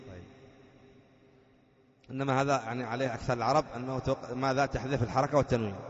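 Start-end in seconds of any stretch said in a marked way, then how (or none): none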